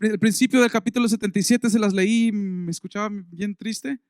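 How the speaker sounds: usual speaking voice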